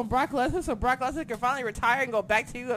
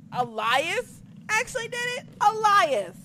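incredulous; 9 semitones higher than usual. Real, not acted. Disbelief at Elias winning.

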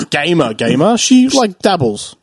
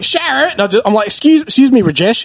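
put-on Aussie bogan accent; 7 semitones higher than usual. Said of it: "pulled out the most Aussie bogan accent".

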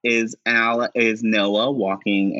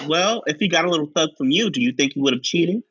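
quick, lightly raised comeback; Ricky's retort; 7 semitones higher, much faster than usual. Retold or imitated. Ricky answering Chance with a quick teasing retort. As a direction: fire back fast. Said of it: "And Ricky says".

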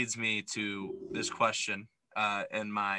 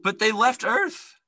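high, loud, stretched-out protest; incredulous objection; 11 semitones higher, 7 dB louder, slower than usual. Real, not acted. Podcaster objecting to cohost's sequel prediction, incredulous. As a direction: object in a high voice, dragging the words out.

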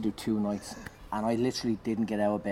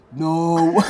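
crowd shouting no; 7 semitones higher, 12 dB louder than usual. Retold or imitated. Crowd answering, loud.

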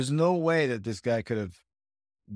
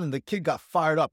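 ordinary talk

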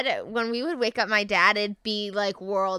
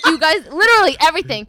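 mock angry dad; 7 semitones higher, 10 dB louder, faster than usual. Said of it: "he was like".

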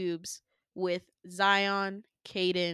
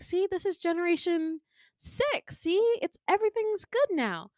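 much higher, eager voice; pitched-up, chirpy reassurance; upbeat villain; 10 semitones higher than usual. Retold or imitated. Villain showing Winter his new clones, upbeat.

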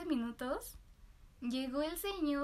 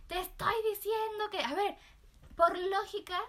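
higher, louder mock yell at the cashier; angry customer yell; 4 semitones higher, 3 dB louder than usual. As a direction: voice the furious man lecturing her. Rude customer yelling at her. (cliente furioso gritándole a la cajera)

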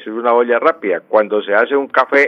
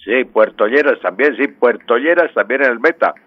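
ordinary delivery

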